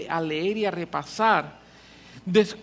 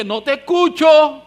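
loud, slow No te escucho with a laugh; playful divine refusal; God refusing; 7 semitones higher, 11 dB louder, slower than usual. Acted. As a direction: answer like God brushing him off. God's reply acted, laughing.

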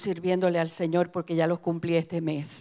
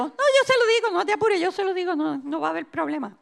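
high, quick pastor brushing off worries; dismissive reassuring pastor; 7 semitones higher than usual. Retold or imitated. Pastor tells the hermana not to worry, high hurried reassurance.

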